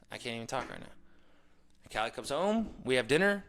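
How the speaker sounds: ordinary talk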